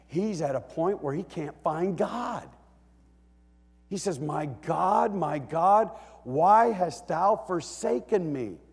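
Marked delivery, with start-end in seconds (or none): 4.2-8.6 raised, drawn-out cry of desperation; acted-out plea, louder and slower at the end; anguished cry to God. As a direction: call out to God in pain, building louder. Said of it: "He is bellowing out for help".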